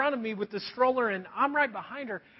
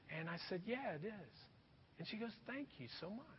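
much softer, gentle retold exchange; hushed retelling; 5 semitones lower, 13 dB quieter than usual. Preacher recalling giving money to a homeless woman, soft and hushed. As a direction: let it sink to a soft, tender hush.

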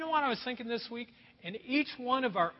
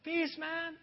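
higher, softer, smiling peace man; mellow peace greeting; 7 semitones higher, 4 dB quieter than usual. Retold or imitated. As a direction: shrug it off with a smile. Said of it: "you can just smile and say".

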